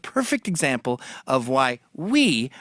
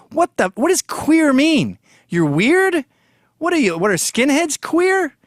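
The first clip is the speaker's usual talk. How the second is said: mock confusion; 11 semitones higher, 5 dB louder than usual. Mocking a confused bigot.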